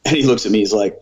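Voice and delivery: ordinary delivery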